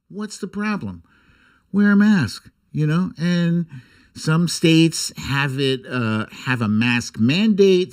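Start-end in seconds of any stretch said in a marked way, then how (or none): 0.1-2.5 exasperated. Real, not acted. Exasperated rhetorical outburst.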